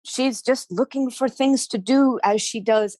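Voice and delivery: plain reading voice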